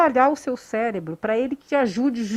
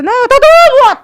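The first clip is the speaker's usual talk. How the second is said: much higher and louder cry of pain; voice shooting up; 12 semitones higher, 12 dB louder than usual. Retold or imitated. Instructor imitating a candidate's voice jumping up, high and loud. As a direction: jump the voice way up, blurting. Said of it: "Aquelas entonações de voz que sobe e desce".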